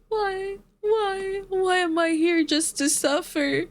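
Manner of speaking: high, plaintive mock lament; mock anguish. Streamer lamenting a sale they can't afford, mock anguish. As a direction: plead hopelessly, hamming up the suffering.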